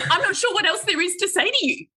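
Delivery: high, fast, fed-up retort; telling the barista she gives up, high exasperated voice. Retold or imitated. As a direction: throw up your hands and give in. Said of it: "I went".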